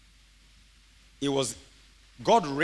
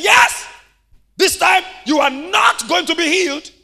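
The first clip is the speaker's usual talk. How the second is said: much higher, louder furious shout; devil shouting; 12 semitones higher, 9 dB louder than usual. Acted. The devil insisting to Hagin, shouting furiously. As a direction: yell it back with full force.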